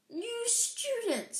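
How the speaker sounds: high, slow, beaming friar; fat friar voice. Acted. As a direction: stretch it out with a jolly smile. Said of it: "said the fat friar, smiling around them".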